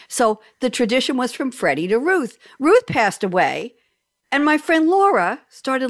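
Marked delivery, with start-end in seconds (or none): none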